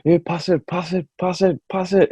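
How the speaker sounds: put-on a light Geordie accent, calling pass it from the stands; mock Geordie shout. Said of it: "There's somebody shouting in their, like, Geordie accent".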